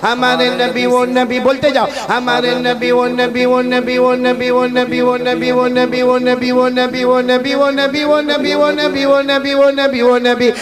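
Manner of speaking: loud, rousing preacher leading the crowd; full-force sermon chant; call-and-response preaching. Acted. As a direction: drive the crowd to answer back.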